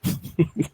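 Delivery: short unvoiced laugh; breathy giggle. Real, not acted. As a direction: giggle through a hiccup. Laughs after hiccup.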